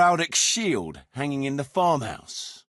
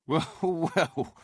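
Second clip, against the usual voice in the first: laughing; 4 dB quieter than usual. Acted. Laughs while answering.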